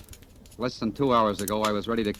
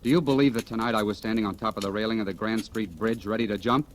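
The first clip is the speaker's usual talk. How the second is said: ordinary talk, nothing marked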